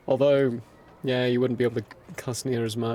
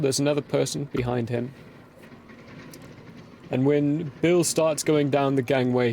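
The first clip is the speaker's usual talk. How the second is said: ordinary talk, nothing marked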